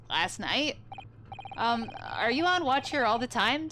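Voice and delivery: usual speaking voice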